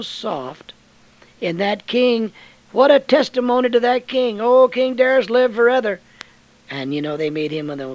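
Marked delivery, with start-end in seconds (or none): 1.4-6.0 loud, high, fiery preaching of David's sling; preaching at full force. Acted. Preacher to the congregation about Goliath, fiery and loud. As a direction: belt the story out like a revival preacher. Preacher exults over King Darius.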